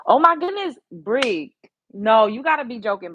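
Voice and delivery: high, loud delighted exclamation; excited surprise. Real, not acted. Streamer reacting to a viewer's nail suggestion, high excited surprise. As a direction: squeal with surprise.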